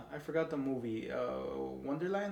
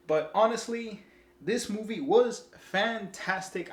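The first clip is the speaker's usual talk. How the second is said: excited praise; 7 semitones higher, 6 dB louder than usual. Real, not acted. Reviewer's excited verdict.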